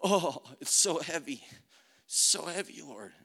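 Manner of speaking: straining under a crushing load; weighed down. Retold or imitated. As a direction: groan it out, then fade away on the last word. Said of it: "like a thousand pounds was on my body".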